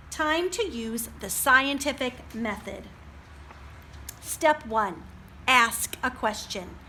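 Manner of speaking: steady picture book read aloud; reading to children. A narrator's reading. Librarian reading to children, steady storybook voice. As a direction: read it out for the little ones.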